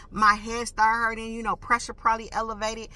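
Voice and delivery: ordinary talk, nothing marked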